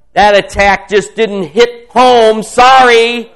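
loud, high mock apologies to the enemy; mocking defiance. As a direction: gloat that every attack bounces off. Prayer rises into mock taunting.

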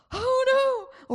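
high, loud gasp of mock alarm; mock fright. Preacher acting out fear's panic, high and loud. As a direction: yelp it like fear just hit you. Fear acted out as a reaction.

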